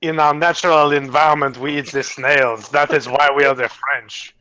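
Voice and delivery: hammed-up French lizard; French accent. Game master's French lizard on eating snails, mock French accent. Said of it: "He's a French lizard".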